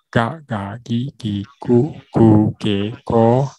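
slow drill 嘎嘎gi gi gu gu ge go, voiced g; syllable drill. Shown as a demonstration. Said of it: "那 嘎 也是".

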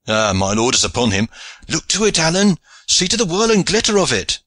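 high, mounting excitement; excited high exclamations; thrilled onlooker. A narrator's reading. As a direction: urge a friend to watch, pitch soaring. Onlooker excitedly watching lord attack.

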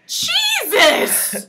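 surprised. Real, not acted. High exclamation.

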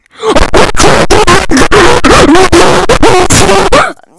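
long, high, blown-out screaming; berserk scream. Acted. Middle schooler who has totally snapped, screaming wildly. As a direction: scream at the absolute top, sustained.

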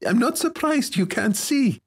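put-on high girlish teasing; giggly teasing. A narrator's reading. Girl making fun of her muffled-up friend, giggly. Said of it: "she giggled".

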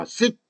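"seek" with the N gone; reduced "sink". Shown as a demonstration. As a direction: say "seek" short, ending on a stopped K. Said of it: "이렇게 되죠".

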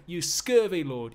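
high, loud, slow insult; drawn-out stage shout; angry insult. Acted. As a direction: throw the insult back hard. Slave trading insults with master.

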